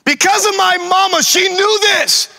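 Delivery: high, shouted sermon delivery; full-force preaching. Real, not acted. Preacher credits a praying mama to the congregation, shouting. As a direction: cry it out to the congregation.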